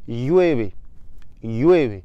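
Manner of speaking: llueve with ll said as y; Spanish ll. Shown as a demonstration. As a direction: start llueve with a y sound. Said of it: "Le verbe, c'est llover".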